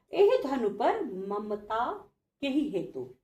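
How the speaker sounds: teacher quietly reciting the verse. Shown as a demonstration. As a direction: let the verse fall away softly. Recites Lakshman's verse line.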